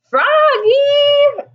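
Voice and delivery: high, loud call; cry. A narrator's reading. Miss Witherspoon calling out to Froggy, loud high call. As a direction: call across the classroom. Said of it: "cried his teacher".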